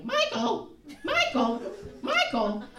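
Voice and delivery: mimicked bird call, starting high and dropping with each call; cawing mynah bird. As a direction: squawk it like a talking bird. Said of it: "this horrible cawing sound".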